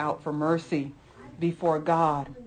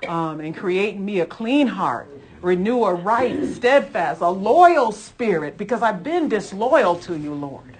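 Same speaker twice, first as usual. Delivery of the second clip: fervent prayer; 2 semitones higher, 5 dB louder than usual. Real, not acted. Preacher praying aloud to God, fervent confession. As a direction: pour out a heartfelt confession to the Lord.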